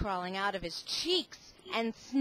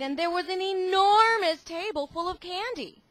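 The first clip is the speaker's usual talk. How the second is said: higher, louder, wonder-filled telling; excited; 9 semitones higher, 9 dB louder than usual. A narrator's reading. Reading to the kids about the candy table, excited. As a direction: marvel at the huge candy pile.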